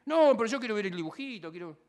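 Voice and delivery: lightly voiced child whining for his cartoon; pleading little boy. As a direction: act the kid who won't go to bed. Child refusing bedtime.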